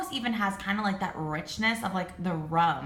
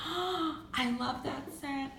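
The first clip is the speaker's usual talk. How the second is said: awed hush; 5 semitones higher, 7 dB quieter, much slower than usual. Real, not acted. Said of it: "I'm so happy right now".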